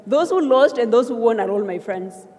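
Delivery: ordinary talk, nothing marked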